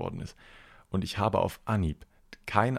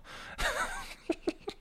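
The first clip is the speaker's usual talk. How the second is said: breathy laugh; 7 dB quieter than usual. Real, not acted. Laugh after bumping hand.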